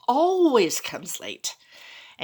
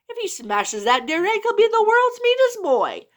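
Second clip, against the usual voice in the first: much higher, louder shrieking protest; shouting wife worm; 9 semitones higher, 6 dB louder than usual. Acted. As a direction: screech back at your husband to save the egg. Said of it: "the wife of the worm shouted back".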